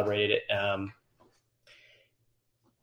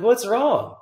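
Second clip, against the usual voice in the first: mock concern; 11 semitones higher, 5 dB louder than usual. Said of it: "they're like".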